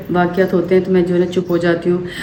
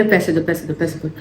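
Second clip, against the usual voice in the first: softer, hushed repeated demand for money; hushed robber demand; 4 semitones lower, 7 dB quieter than usual. Retold or imitated. Robber to her in the lane, hushed menacing demand. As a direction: menace it under the breath. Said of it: "फिर कहे".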